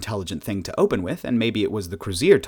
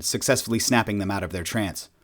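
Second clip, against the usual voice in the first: ordinary delivery, nothing marked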